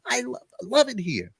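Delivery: high-pitched, insistent defence; mock protest. Acted protest back to wife.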